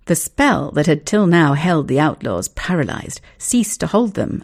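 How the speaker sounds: usual speaking voice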